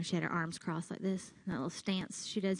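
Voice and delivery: ordinary delivery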